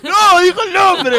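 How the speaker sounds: high, loud, drawn-out yell; scandalised outcry. Real, not acted. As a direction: cry out at the blunder, stretched and high. Outcry at a name let slip.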